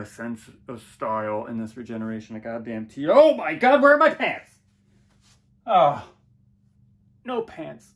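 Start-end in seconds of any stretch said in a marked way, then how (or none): 3.1-4.5 very high, very loud panic; shrieking alarm, high and loud; panicked shout. Acted. Discovers he has no pants. 5.7-7.9 high, loud dismay; high, loud cry of dismay; alarmed cry. Acted. Continues pants panic.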